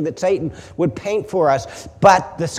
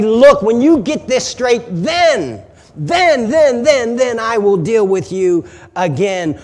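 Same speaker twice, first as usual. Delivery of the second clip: raised, fed-up mock scolding; mock disgust; 4 semitones higher, 6 dB louder than usual. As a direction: act out washing your hands of someone, hammering the repeated "then". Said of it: "he doesn't throw up his holy hands in disgust".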